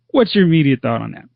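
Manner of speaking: ordinary talk, nothing marked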